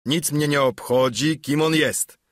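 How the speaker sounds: mock indignant innkeeper; calling out. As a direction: Silver brushing it off loudly. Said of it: "zawołał Silver".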